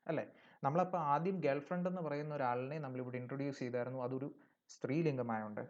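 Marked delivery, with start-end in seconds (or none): none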